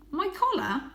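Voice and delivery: voiced high and light as a young cat; a proud little cat boasting. A narrator's reading. Young Varjak boasting his breed to the stray.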